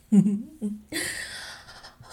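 short laugh with a rise; a quick giggle between whispers. Acted. To her partner, caught by surprise, a short laugh. Giggle mid intimate scene.

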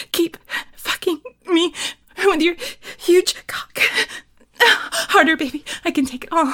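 loud, high moans in broken phrases; climactic roleplay moaning. Acted. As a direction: gasp the words out, building to loud cries. Performs an intimate roleplay.

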